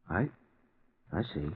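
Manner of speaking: hushed and shaken, voice sinking low; numb, barely audible reply; stunned quiet. Acted. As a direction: let the news knock the breath out of you. Stunned by news on phone.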